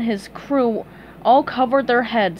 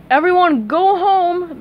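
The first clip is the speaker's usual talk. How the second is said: higher, louder, slow proclamation; booming director's announcement; announcing; 8 semitones higher, 6 dB louder, slower than usual. A narrator's reading. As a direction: call out to the whole set. Barry announces.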